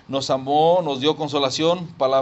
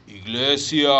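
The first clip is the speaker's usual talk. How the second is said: lightly put-on commanding voice, drawn out; slower than usual. Preacher imagining the Lord calling the church, mock voice of command. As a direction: stretch it out like a summons from heaven. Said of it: "imagínense una voz de mando".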